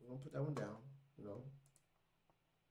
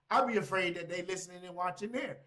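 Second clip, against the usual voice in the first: usual speaking voice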